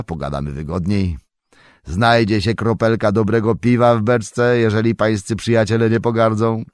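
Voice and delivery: a jovial farmer welcoming guests; hearty farmer. A narrator's reading. Farmer Maggot invites them in. (serdeczny głos farmera)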